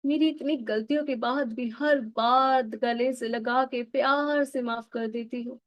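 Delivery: ordinary talk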